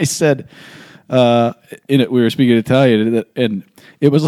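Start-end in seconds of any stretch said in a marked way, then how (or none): none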